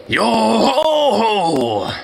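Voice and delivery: a slow, hoarse pirate yo ho ho; raspy pirate. Shown as a demonstration. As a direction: croak it out like an old pirate singing along. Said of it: "That raspiness actually does fit".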